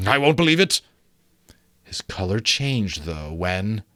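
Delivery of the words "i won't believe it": put-on higher, stubborn old miser; Scrooge defiant. Scrooge brushing off the ghost, stubborn scoff.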